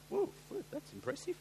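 high, airy mock amazement; amazed. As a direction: pitch up, a bit impressed. Retold reaction to the CEO reveal.

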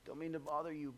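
soft, sheepish wake-up call to Jesus; sheepish hush. Retold or imitated. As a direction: tiptoe in, not wanting to bother. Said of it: "wake him up and say".

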